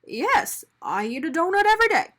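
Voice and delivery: quick sheepish admission; sheepishly. A narrator's reading. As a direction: confess a bit embarrassed. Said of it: "Mister Bing replied sheepishly".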